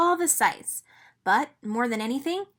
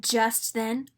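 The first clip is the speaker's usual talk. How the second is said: ordinary delivery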